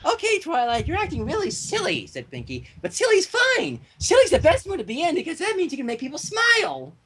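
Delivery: high, chirpy, cheerful pony; sing-song upbeat pony voice; bubbly Pinkie Pie. Acted.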